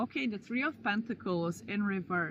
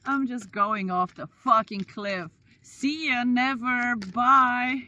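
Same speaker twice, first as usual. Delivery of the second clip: cheerful yell; 4 semitones higher, 8 dB louder than usual. Pretend. Mock carefree cliff goodbye.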